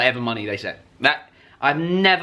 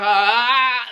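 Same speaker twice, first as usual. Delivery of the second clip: gasp; 8 semitones higher, 3 dB louder than usual. Retold or imitated. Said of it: "I went like".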